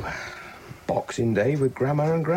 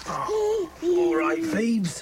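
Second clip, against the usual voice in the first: put-on soothing a child; much slower than usual. Father takes crying baby.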